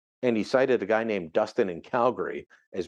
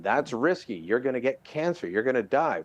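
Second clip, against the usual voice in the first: usual speaking voice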